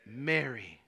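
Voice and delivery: low, soft and slow; tender naming. Acted. Jesus softly calling Mary by name. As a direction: say her name gently, like a whisper of recognition.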